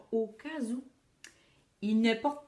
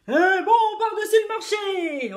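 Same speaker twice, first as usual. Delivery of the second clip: high, slow roaring chorus of sailors; 8 semitones higher, 7 dB louder than usual. A narrator's reading. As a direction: roar it back like a rowdy crew. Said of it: "ont rugi les matelots".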